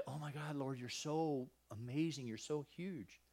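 soft, hushed worshipful wonder; joyful awe. Acted. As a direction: marvel quietly in prayer. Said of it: "Some can be joy".

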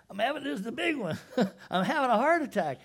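high, fast, laughing panic; mock heart attack. Brother finding the money, laughing mock panic. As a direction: ham up a brother clutching his chest. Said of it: "their hearts failed them".

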